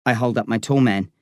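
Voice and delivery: ordinary delivery, nothing marked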